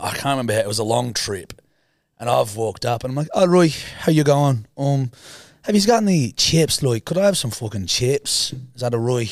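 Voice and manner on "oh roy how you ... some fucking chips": lilting, higher Irish voice; put-on Irish accent. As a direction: pretend to be Irish, hammed up. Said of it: "Pretended to be Irish".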